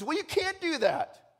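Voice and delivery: high, slow incredulous protest; objecting student. Acted. Student rejects the missionary plan, high incredulous protest. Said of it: "And the student says".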